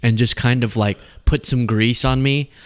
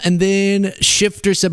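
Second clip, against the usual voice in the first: ordinary talk, nothing marked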